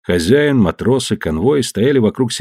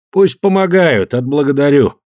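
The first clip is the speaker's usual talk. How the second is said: merchant voice; 5 semitones higher than usual. Acted. Merchant orders the guards.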